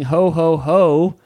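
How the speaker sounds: playful jolly Santa imitation. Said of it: "We've got Santa".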